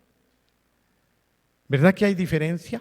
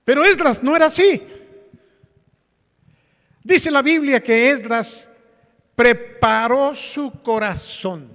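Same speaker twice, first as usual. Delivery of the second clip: loud, high, slowing proclamation from the pulpit; full-force preaching; 9 semitones higher, 8 dB louder than usual. Acted.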